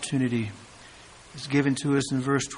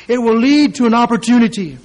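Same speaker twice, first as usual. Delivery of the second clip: "It will lead to an opportunity" higher and louder; emphatic preaching; 5 semitones higher, 7 dB louder than usual. Shown as a demonstration. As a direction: press "lead" hard. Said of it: "repeated phrase".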